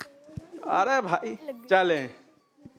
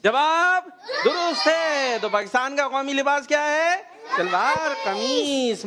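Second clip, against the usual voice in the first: drawn-out, high verdict call; quiz show announcer; 5 semitones higher, slower than usual. Real, not acted. Host confirming a child's answer, announcer style. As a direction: stretch the verdict out for the audience.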